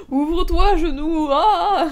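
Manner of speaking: loud, high cry breaking into laughter; mock commanding shout. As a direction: bellow a silly incantation while laughing. Mock command amid laughing fit. (faux cri solennel dans un fou rire)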